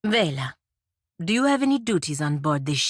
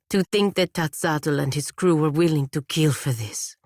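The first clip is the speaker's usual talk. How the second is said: normal talking voice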